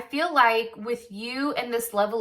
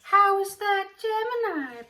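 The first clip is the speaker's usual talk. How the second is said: high, slow, snooty mock voice; whiny put-on voice of a hater; mocking a commenter; 10 semitones higher than usual. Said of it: "that one asshole in the comments that's like".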